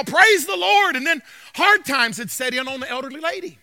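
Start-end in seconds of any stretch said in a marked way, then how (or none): 0.0-1.0 louder joyful yell; yelled praise. Retold or imitated. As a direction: call it out from the porch. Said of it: "stand on her porch and yell".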